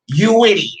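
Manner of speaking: loud put-on jeering "You idiot"; hammed-up scorn; mocking insult. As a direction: toss the catchphrase at them in a silly voice. Catchphrase insult at absent fans.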